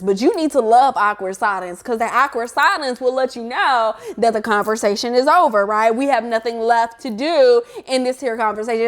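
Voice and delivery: ordinary delivery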